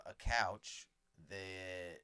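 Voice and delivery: ordinary talk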